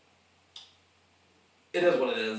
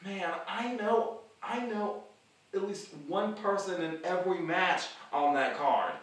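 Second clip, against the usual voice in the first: excited inner thought; 5 semitones higher than usual. Retold or imitated. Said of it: "I was like".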